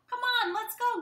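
high, loud call to a friend; friend shouting. Acted. Friend shouting for mom to come jog. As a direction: call her out to run. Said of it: "her friend shouted real loud".

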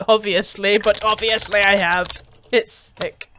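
smug. Acted. Voicing game character's reply.

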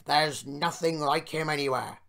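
loud, hearty public address; big projected speech-making voice; proud speech. A narrator's reading. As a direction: declare it proudly to the crowd. Owner's proud speech to the crowd.

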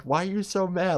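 high-pitched mock questioning of an angry man; high, teasing imitation; imitated patronizing rider. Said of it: "this guy is just like".

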